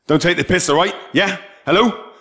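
exasperated calling. Acted. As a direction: nag someone who has headphones on. Trying to get headphoned Shai's attention.

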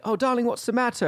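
high, concerned British gentleman; put-on British accent, cooing with concern. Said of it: "gonna say it in a British accent".

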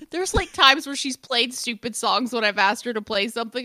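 ordinary delivery

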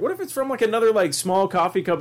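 playful Irish lilt; Irish accent. Retold or imitated. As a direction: mimic an Irish radio host. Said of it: "doing all these different Irish accents".

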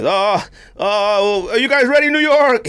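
flustered announcer, pitch climbing; hesitate, then call out high to the crowd; Michael Buffer. Retold or imitated. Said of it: "And he just like this".

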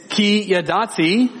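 "ki yadati" said slowly in Hebrew, each syllable clear; careful Hebrew "ki yadati", unhurried; Hebrew word. Shown as a demonstration. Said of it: "that word, "I know," in verse seven, it's".

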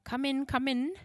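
put-on welcoming, light German accent. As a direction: usher them in with a German lilt. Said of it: "Herr Gutmann had a heavy German accent".

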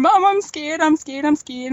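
high, mock tearful child's voice, only a touch of real crying; mother imitates her daughter crying in the doorway, lightly tearful; imitating a scared child. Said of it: "crying and going".

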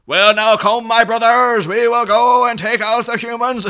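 loud, high, exaggerated Irish accent; hammed-up Irish rallying shout; Magneto gone Irish. Podcaster mocking Magneto's beach speech, full Irish shout. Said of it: "he's switched into full Irish".

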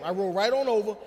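ordinary talk, nothing marked

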